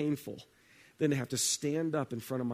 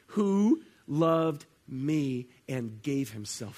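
"who loved me and gave himself" drawn out slowly, louder; slow emphatic scripture; 2 semitones lower, 6 dB louder, much slower than usual. Shown as a demonstration. Preacher hammering home the verse slowly. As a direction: stretch out each word with weight. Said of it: "repeated emphatic scripture".

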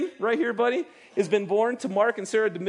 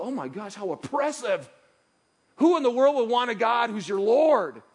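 scoffing complaint swelling louder; mock indignation; 3 semitones higher, 4 dB louder than usual. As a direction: play the offended skeptic. Mocking a reluctant listener.

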